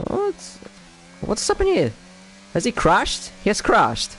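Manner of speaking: sudden high shocked question; surprised. Real, not acted. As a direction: react to a surprise crash. Driver suddenly retires.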